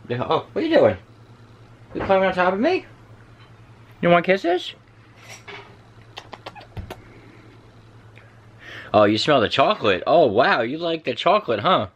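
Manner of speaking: high, swooping talk to a small animal; pet talk. Real, not acted. Owner to the pet climbing up and sniffing chocolate, cooing pet talk. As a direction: coo at a pet in lilting high pitch.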